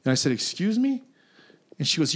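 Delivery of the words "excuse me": high-pitched, questioning "excuse me?"; affronted disbelief. Retold or imitated. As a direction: retell it as an incredulous comeback, pitch leaping up. Acts out his offended reply.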